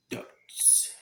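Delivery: whispered final word; whispering. Acted. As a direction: drop to a breathy whisper. Playful sign-off of the video.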